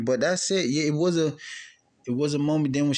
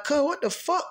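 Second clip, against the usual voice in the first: high-pitched retold bewilderment; reenacted baffled reaction; bewildered. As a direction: ask it high, mildly thrown. Retold bewildered reaction to crying cousin.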